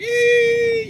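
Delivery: put-on very high squeal of a crying baby; imitated baby cry. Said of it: "The baby be crying like".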